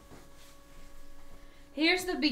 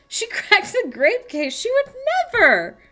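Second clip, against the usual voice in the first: mock indignation; 11 semitones higher, 5 dB louder than usual. Mock defence.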